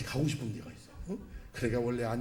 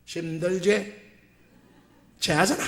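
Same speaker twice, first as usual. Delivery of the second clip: lightly voiced Gyeongsang dialect scold; a quick Gyeongsang dialect jab, lightly done; 4 semitones higher, 5 dB louder, slower than usual. Ulsan pastor scolding again in Gyeongsang dialect. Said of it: "경상도 말로".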